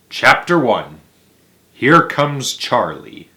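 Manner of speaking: ordinary delivery, nothing marked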